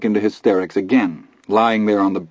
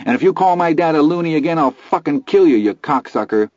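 high, fast enraged threat; furious threat; 8 semitones higher than usual. Acted. Teddy threatening Milo.

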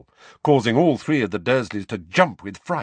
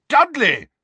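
loud, high-pitched roar; 8 dB louder than usual. A narrator's reading. Uncle demanding answers about his son, roaring. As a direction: roar out the name. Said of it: "roared Uncle Vernon".

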